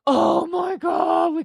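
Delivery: loud, high, stretched cry of joy; excited shout. Real, not acted. Opening a pack, thrilled loud shout. Pulls a wanted card.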